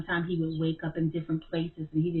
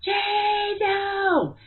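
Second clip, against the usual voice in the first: high, loud call of a name; retelling a sudden shout of a grandmother's name; yelled name; 4 dB louder than usual. Retold or imitated. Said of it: "I just yelled it out into the atmosphere".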